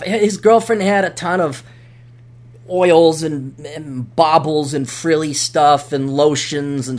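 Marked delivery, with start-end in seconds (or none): none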